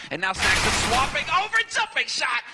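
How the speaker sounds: rising, excited call of the play. Real, not acted. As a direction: call the jumping shot with a burst. Caster calls a big play.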